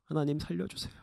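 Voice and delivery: soft, low pleading; desperate hushed prayer. Acted. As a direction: beg God quietly for rescue. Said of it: "모세는 하나님께 기도해요".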